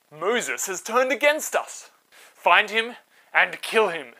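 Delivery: loud, slow, commanding order; royal command. Acted. Palace ordering soldiers to find Moses, loud command. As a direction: bark the death order slowly.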